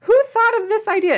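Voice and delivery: high, loud squeal of disbelief; shrieking with delight at a find; excited shriek. Retold or imitated. Retelling finding fried cucumbers at Costco, high excited squeal. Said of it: "I was screaming inside Costco".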